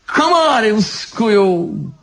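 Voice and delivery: high, drawn-out order; commanding. A narrator's reading. Elegant devil ordering the musicians, high command. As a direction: sing out the order, stretched and high. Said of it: "велел изящный музыкантам".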